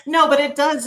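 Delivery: ordinary delivery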